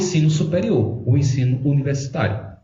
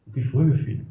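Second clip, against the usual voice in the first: hushed family talk; 3 semitones lower, 5 dB quieter, faster than usual. Retold or imitated. Mother and son dialogue retold.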